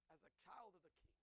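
normal talking voice

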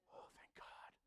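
breathless whispered relief; relieved whisper. Acted. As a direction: whisper it like you barely survived. Priest relieved after meeting God.

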